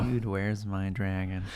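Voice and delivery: low, slow, soft trailer-voice title; mock dramatic title. Player savoring the new campaign name: low slow trailer read. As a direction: intone it like a film title.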